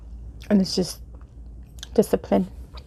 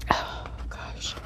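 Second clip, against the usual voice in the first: hushed breathy whisper; 9 dB quieter than usual. Real, not acted. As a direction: sigh it out in a whisper. Reacting to strawberry taste, ASMR.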